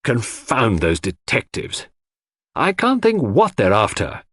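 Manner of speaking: indignant grumble, pitch climbing as it goes; exasperated anger. A narrator's reading. John fuming about detectives searching every room, exasperated anger. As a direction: complain crossly, leaning on the grievance. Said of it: "wore an unaccustomed frown of anger".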